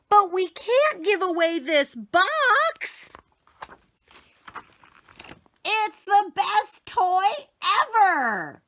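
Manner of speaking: put-on high, slow gleeful child voice; delighted child. Boy telling mother the box is best, high slow glee. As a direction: savour it with glee.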